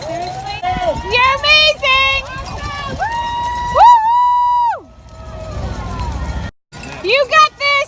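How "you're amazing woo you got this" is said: cheering. Real, not acted. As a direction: yell encouragement to runners. Cheering runners passing by.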